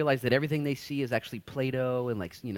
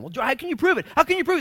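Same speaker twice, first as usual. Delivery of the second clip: challenging friends; 11 dB louder, faster than usual. Retold or imitated. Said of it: "George has this conversation with all of his friends".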